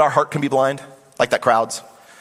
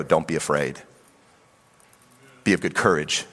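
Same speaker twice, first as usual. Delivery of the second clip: tender reassurance; 7 semitones lower than usual. Acted. God encouraging Joshua before Jericho, low and calm. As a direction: reassure him gently in a lowered voice.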